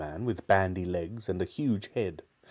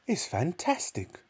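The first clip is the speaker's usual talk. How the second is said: excited shout; 6 semitones higher, 3 dB quieter than usual. Acted. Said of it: "Mister Cranky shouted".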